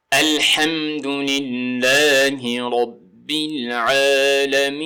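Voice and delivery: very slow recitation with long sustained vowels; tajweed recitation. Shown as a demonstration. Teacher reciting the next verse for learners, tajweed recitation. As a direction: recite measured, holding the last syllable long. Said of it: "تجوید کے مطابق پڑھنا".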